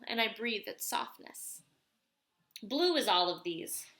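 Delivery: usual speaking voice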